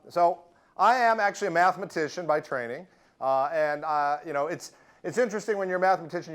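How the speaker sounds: ordinary talk, nothing marked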